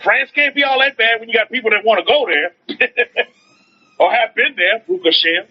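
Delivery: usual speaking voice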